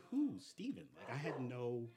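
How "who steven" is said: confused. Retold or imitated. Retold clueless reply.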